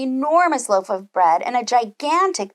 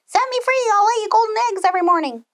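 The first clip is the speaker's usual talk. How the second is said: much higher, fast hen voice pleading; hen's plea; 11 semitones higher, 3 dB louder, faster than usual. Acted. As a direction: cluck the plea out in a rush.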